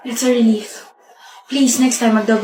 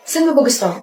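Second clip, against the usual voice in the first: usual speaking voice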